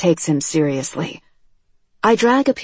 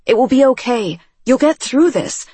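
loud, high soothing words; shouted comfort; 7 semitones higher, 4 dB louder than usual. A narrator's reading. Girl shouting comfort at her screaming friend, loud. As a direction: shout reassurance at him. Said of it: "I shout everything I have heard".

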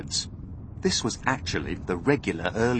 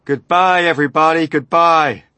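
loud, raised parting cry; shouted farewell; 6 semitones higher, 11 dB louder than usual. A narrator's reading. Men expecting the bomb shout goodbye, loud farewell. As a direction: call goodbye to a whole crowd.

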